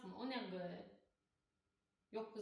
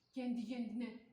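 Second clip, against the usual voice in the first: normal talking voice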